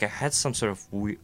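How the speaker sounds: usual speaking voice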